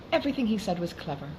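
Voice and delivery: ordinary delivery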